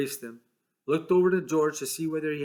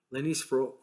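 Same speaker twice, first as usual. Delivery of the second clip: ordinary delivery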